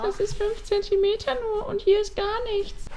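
high squeaky mocking imitation. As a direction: mimic her in a squeaky high voice. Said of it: "Machst du mich gerade mit einer quietschigen Stimme nach?". (quietschige Stimme, spöttisch nachgemacht)

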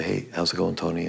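quiet, gentle teacher's check-in; hushed, kindly question; soft-spoken Charlie. Retold or imitated. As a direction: ask softly like a kind old coach. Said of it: "soft-spoken man".

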